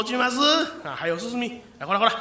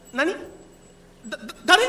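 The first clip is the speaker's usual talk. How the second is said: startled stammer; 6 semitones higher, faster than usual. Acted.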